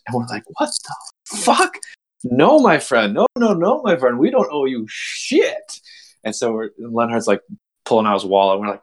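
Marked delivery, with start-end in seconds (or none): 0.6-1.8 high, drawn-out disbelief; acted-out incredulous outburst; mock outrage. Group reacts to huge bill. 2.2-5.8 high, emphatic mock protest; acted-out defiant haggling; indignant refusal. Acted refusal to pay bouncer.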